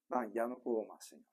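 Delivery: a soft, drained sigh of giving up; a believer sighing to God that strength is gone, soft and drained; exhausted sigh. Acted. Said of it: "nuestras fuerzas hacen así".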